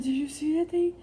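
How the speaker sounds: frantic shouted disbelief; panicked boyfriend. Retold or imitated. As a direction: blurt it out, still shaken by the bug. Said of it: "he said".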